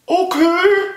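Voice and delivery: high, squeaky unicorn voice; tiny high-pitched creature reply. Pretend. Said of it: "That's my unicorn voice".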